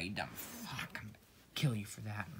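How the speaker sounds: ordinary talk, nothing marked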